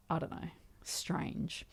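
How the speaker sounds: whispered aside. Real, not acted. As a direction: drop to a whisper for the verdict. Aside about strange people.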